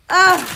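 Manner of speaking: loud startled groan of disgust; disgusted ugh. Real, not acted. Spider scare, disgust.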